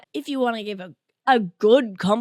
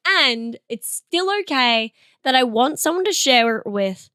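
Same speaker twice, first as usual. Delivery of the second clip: ordinary delivery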